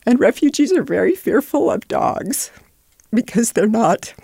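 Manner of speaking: choked up, pitch climbing in places; emotional. Real, not acted. Refugee helper recounting a frightened mother's story, emotional. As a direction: fight through real emotion as you tell it. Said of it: "I get emotional".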